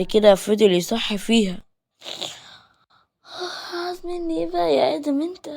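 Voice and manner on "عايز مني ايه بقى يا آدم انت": put-on high, drowsy grumble of a girl woken up; irritated young wife dragged out of sleep; sleepy annoyed girl. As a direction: whine it half asleep, high-pitched. Woken girl complains to Adam.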